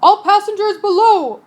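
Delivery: ordered. Acted. Captain ordering passengers below deck. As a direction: command it over the wind. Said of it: "ordered the captain".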